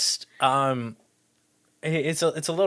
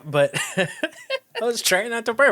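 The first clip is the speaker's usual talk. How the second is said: laughing; 7 semitones higher than usual. Real, not acted. Laughing through burp excuse.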